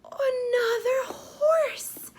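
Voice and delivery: high, delighted exclaim of horse; excited reveal. Shown as a demonstration. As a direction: gasp out the surprise for the child.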